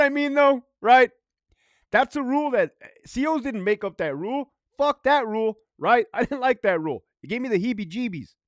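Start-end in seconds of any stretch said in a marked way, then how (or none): none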